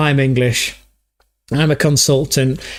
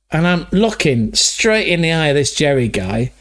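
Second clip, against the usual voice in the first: usual speaking voice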